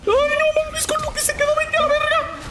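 high exasperated shriek; outraged outburst. Real, not acted. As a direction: screech it in disbelief. Enemy escapes low health, outburst.

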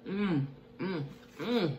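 normal talking voice

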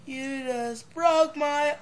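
mock heartbreak.

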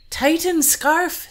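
high, loud, slow order; harsh command. Acted. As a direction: bark the command to crush her. Bride punishes the lying queen.

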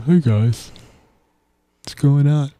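lightly put-on voice of a chill guy; casual greeting in a borrowed voice; Hunter impression. Host tries his boyfriend impression on the show, a light put-on guy voice. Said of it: "my Hunter impression".

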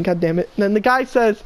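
ordinary talk, nothing marked

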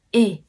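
e said plainly; Korean possessive e. Shown as a demonstration. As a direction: say e, not ươi. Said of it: "mình đọc là".

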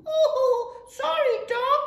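high, loud little squeal of apology; timid little kid goat. A narrator's reading. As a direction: apologise as a startled baby goat.

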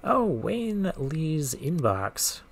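usual speaking voice

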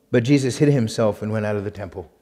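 normal talking voice

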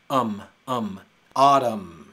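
um um leading into a loud Autumn; um then Autumn. Shown as a demonstration. Teacher building Autumn from the um sound. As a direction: hum um twice, then say Autumn. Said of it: "tiene el sonido de um".

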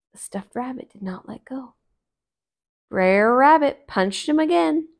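plain reading voice

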